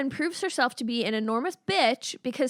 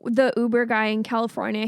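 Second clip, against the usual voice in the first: normal talking voice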